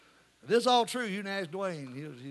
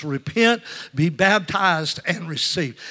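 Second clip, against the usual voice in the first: loud "Repent", long "baptized", falling "receive"; emphatic preaching refrain; 11 dB louder, slower than usual. Real, not acted. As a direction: hammer out the three steps, settling at the end. Repeats the slogan for the congregation.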